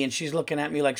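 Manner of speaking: usual speaking voice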